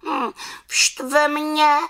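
very high, slow sulky witch; cross little witch. A narrator's reading. Little witch telling what annoys her, high cross voice. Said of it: "řekla malá čarodějnice".

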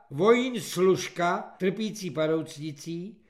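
ordinary delivery

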